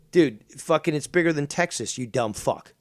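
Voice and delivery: ordinary talk, nothing marked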